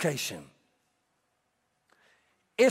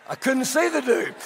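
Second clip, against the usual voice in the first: usual speaking voice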